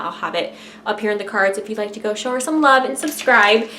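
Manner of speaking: usual speaking voice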